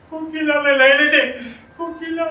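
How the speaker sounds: very high, very loud wail of grief; full-force, high-pitched cry of bereavement; anguished outcry. Acted. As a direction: scream the loss at the top of the voice. Widower cries out for dead wife.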